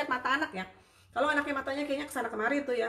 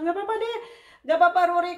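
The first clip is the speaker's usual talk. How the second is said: high, loud cheerful reassurance; imitating the therapist; 9 semitones higher, 11 dB louder, faster than usual. Mother imitating the therapist brushing off her worry, cheerful.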